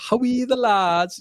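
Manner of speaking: high-pitched, slow Sunderland chant; attempted Sunderland accent. Shown as a demonstration. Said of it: "Is that a little bit of Sunderland?".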